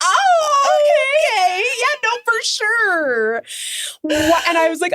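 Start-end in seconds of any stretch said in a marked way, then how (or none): 0.0-3.4 much higher, slow, strained laughing reply; awkward polite waitress. Retold or imitated. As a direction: humour an odd customer.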